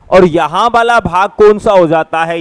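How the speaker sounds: ordinary talk, nothing marked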